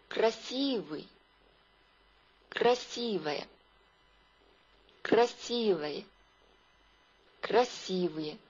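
красивая with a softened, reduced unstressed ending; weakened endings. Shown as a demonstration. Teacher showing learners Russian adjective endings, weakened final vowels in красивый. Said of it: "我们要记得弱化，比如说".

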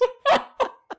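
shrieking high laugh; loud laughing burst. Real, not acted. Joking about wind directions.

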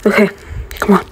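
normal talking voice

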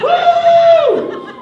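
high, loud whoo of delight; excited whoop. Real, not acted. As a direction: cheer the volunteer on with a whoop. Host cheering the volunteer.

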